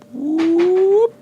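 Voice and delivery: high, loud held "whoop"; playful high-pitched swoop noise; whoop sound effect. Shown as a demonstration. Imitated sliding noise moving down page.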